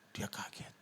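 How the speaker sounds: sudden hushed whisper. Real, not acted. Preacher tells the congregation the driver was shocked, whispering. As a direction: drop to a breathy aside. Dramatic hush in story.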